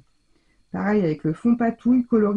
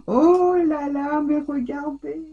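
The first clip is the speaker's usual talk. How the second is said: moved to tears; 7 semitones higher, slower than usual. Real, not acted. Overwhelmed by beautiful gift.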